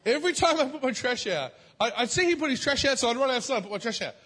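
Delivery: ordinary talk, nothing marked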